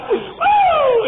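a loud, high whoop of joy; joyful holler. Real, not acted. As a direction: let out a big happy woo.

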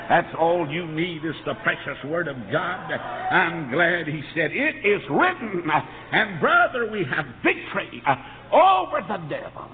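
emphatic revival cadence, key words punched; fervent preaching. Real, not acted. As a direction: preach it with mounting force. Preacher builds to victory cry.

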